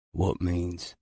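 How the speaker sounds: ordinary delivery, nothing marked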